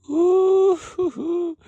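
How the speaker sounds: high, loud hype hooting; excited hooting. Real, not acted. Hyping the banger matchup, loud excited hoots. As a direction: whoop like a hyped fan.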